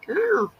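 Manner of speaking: high squeaky mock cat cry; playful high meow-like yelp; imitated cat yowl. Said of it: "this cat is like".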